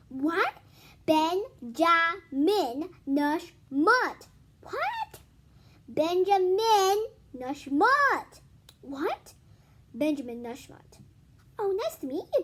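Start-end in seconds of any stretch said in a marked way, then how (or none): 0.1-4.3 drawn-out, very slow louder name; slow emphatic repeat. Retold or imitated. 4.6-5.2 very high puzzled what; squeaky what. Retold or imitated. Adult fails to hear name. 5.9-8.4 loud, separated repeat; louder name. Retold or imitated. Shouting name again.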